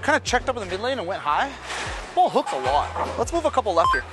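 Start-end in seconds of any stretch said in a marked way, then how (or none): none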